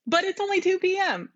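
high, quick disbelief; surprised. Acted. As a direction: blurt it out in surprise. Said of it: "surprised reply".

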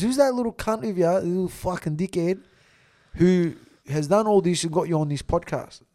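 模仿哥哥暴怒骂人，大声; retold angry rant. Brother raging about podcast.

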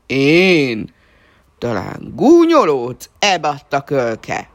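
loud, slow menacing dog voice; angry big dog; gruff big dog. A narrator's reading. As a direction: threaten the puppy gruffly. Big dog snarls at puppy.

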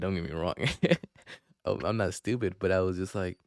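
ordinary talk, nothing marked

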